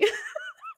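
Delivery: high, soft laughing fit; giggle. Real, not acted. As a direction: dissolve into quiet high laughter. Laughs after quoting the plot.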